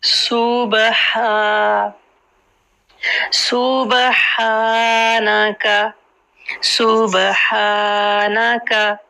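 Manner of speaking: सूबहा said slowly with a clear breathy ह; सूबहानका recited slowly with every sound distinct; सूबहा slow, ह clearly voiced; Qur'anic recitation. Shown as a demonstration. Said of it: "सुब बा कलकला".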